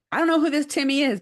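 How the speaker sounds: put-on high, loud, fast indignant kid; indignant retort. Son Dominic snapping back about Timmy, high and loud. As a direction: brush off the stranger's name with outrage.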